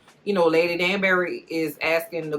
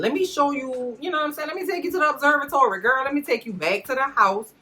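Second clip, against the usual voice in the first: royal charm; 8 semitones higher than usual. Retold or imitated. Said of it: "turns on Thine Royal Charm".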